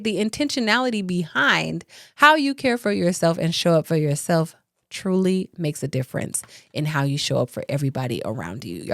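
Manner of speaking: usual speaking voice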